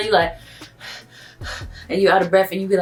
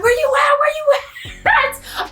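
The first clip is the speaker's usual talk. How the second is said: much higher shouted grandma voice, laughing at the end; grandma calling; slower than usual. Retold or imitated. Grandma calling the late narrator, high mock shout. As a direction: holler it high like grandma on the phone. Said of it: "grandma calling me like".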